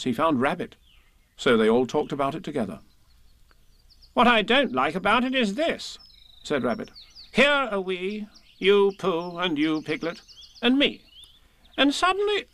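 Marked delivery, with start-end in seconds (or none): 4.1-6.0 high, emphatic story-character voice; bossy Rabbit. A narrator's reading. 7.3-12.4 high, emphatic story-character voice; bossy Rabbit. A narrator's reading.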